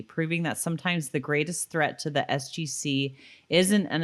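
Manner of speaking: steady read-aloud of a written episode review. Acted. Wife reading out the episode review, in a steady read-aloud voice. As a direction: read it out like a polished summary.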